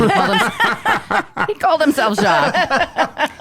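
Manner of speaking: high, helpless laughter through the words; laughing. Real, not acted. Laughing at chairman's assumed French name.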